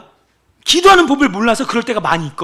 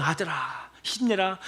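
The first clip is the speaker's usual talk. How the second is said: God encouraging; 4 semitones lower, 13 dB quieter, slower than usual. Retold or imitated. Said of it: "하나님 막 격려하세요".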